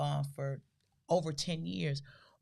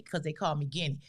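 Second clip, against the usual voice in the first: ordinary delivery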